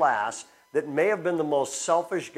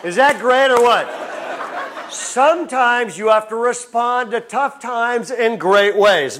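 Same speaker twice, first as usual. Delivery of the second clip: laughing punchline; 3 semitones higher, 4 dB louder than usual. Real, not acted.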